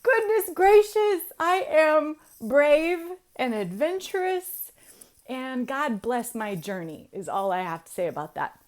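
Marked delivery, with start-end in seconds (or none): 0.0-4.7 high, very loud, drawn-out wail with laughter; over-the-top laughing hysterics, stretched words; comic freak-out. Acted. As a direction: shriek it in hammed-up horror, laughing. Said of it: "I'm here freaking out like".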